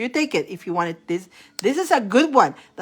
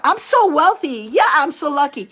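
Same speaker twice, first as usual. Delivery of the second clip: cheering up; 5 semitones higher, 4 dB louder than usual. Shown as a demonstration. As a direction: cheer yourself on with it. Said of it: "like, cheering up".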